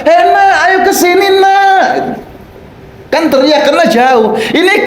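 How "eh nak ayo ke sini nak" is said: high-pitched call across a distance; calling out to child. Acted. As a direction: call your child over from far away. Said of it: "anaknya di seberang sana".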